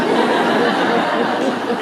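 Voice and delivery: loud, high burst of laughter. Real, not acted. Priest laughing at the story's punchline, loud and high.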